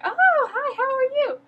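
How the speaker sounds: high, chirpy fan greeting; mock friendly greeting. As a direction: greet him like an overeager stranger. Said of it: "were just like".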